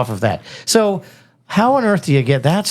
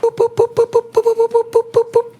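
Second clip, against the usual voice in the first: very high boop boop news jingle; high beeping news jingle. Acted. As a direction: sing a news alert jingle. News jingle sound effect.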